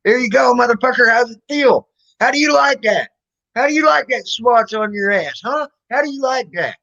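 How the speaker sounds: retold mother shouting at the principal while swatting him; mom's furious taunt. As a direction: hammer every taunt home like a swat. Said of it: "and saying".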